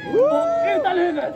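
put-on triumphant kids' show host shout; excited shout. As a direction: show off the trick proudly. Showing off a trick.

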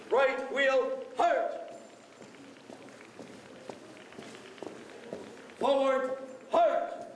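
sharp, drawn-out military command calls; drill commands. Acted. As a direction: call each command crisply to the squad.

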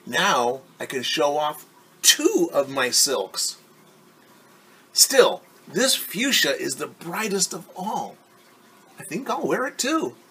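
put-on a vain girl delighted with herself; Ari showing off.

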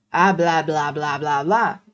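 low, monotonous mock chatter; dismissive blah-blah. Creator brushing off people questioning the changes, dismissive mimicry. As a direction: rattle off complaints as empty noise. Mimics empty complaints of critics.

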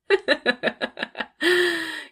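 high villainous laugh, drawn out; long mocking cackle. Boss laughing at her new assistant, long high cackle. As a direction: cackle at his expense.

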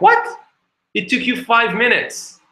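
high, indignant disbelief; shocked woman. Retold or imitated. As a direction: balk at the price. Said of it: "She's like".

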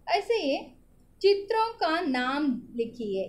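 ordinary talk, nothing marked

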